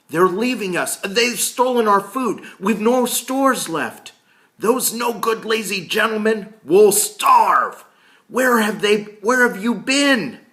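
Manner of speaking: high, loud, frantic shouting; clamoring crowd. Acted. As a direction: shout over each other in panic. Everyone clamoring at once.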